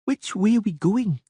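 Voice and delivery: put-on high girlish voice, sulky; a child sulking on the road; glum little girl. Ciri glumly asking the Witcher the way, high sulky voice. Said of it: "Ciri asked glumly".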